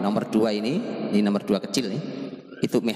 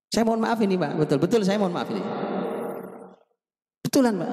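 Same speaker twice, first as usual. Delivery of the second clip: pleading apology; 8 semitones higher than usual. Real, not acted. Preacher pleads with the audience.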